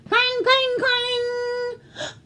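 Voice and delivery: high sing-song clang; bell clanging. Acted. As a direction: ring out like alarm bells. Imitated alarm bells.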